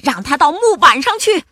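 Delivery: high, loud pirate command; shouting. A narrator's reading. Pirates condemning the monster to the plank, shouting. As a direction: yell the order to the crew. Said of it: "大喊道".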